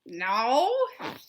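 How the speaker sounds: ordinary delivery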